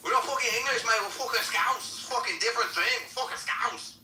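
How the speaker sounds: very high, hammed-up Liverpool accent; indignant mock Scouser at full tilt; Scouse accent. Shown as a demonstration. Showing off Liverpool for friends, indignant high Scouse. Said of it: "I can actually do Liverpool".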